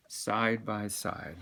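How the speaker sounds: usual speaking voice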